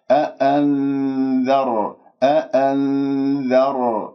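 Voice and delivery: slow "أأنذر", thin dh, long nasal n; light dhal. Shown as a demonstration. Teacher models it rightly for students, light dhal in أأنذر. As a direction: keep the ذ light before the heavy ر. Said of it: "ولا أفخم الذال بسبب الراء".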